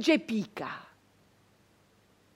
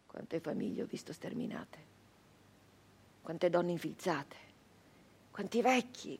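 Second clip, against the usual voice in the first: whispered, grieving and much softer; hushed grief; 12 dB quieter than usual. Acted. Brigand mourns the slaughtered families in a hushed voice. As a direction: breathe it out like a mourner.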